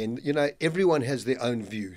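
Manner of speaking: normal talking voice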